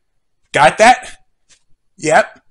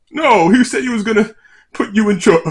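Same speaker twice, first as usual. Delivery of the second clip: gagging mid-sentence; words choked off by retching; dry heaving; 6 semitones higher than usual. Real, not acted. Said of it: "Sorry, I'm gagging".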